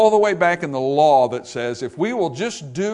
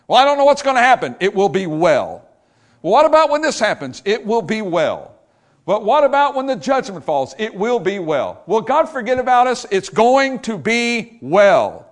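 rising call and answer; 5 semitones higher, 4 dB louder than usual. Acted. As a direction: toss the worry up, land the promise. Call and response with himself.

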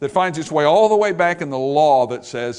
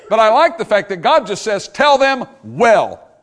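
excited preaching; 5 semitones higher, 7 dB louder than usual. Acted. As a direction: lift it up with joy.

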